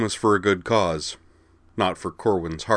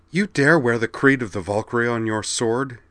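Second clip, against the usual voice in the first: indignant, slightly higher snarl; 4 semitones higher than usual. A narrator's reading. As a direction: snarl the challenge in outrage. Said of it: "she snarled".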